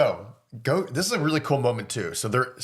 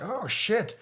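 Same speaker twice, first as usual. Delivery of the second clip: surprised; 9 semitones higher, 3 dB quieter than usual. Retold or imitated. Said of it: "And you're like".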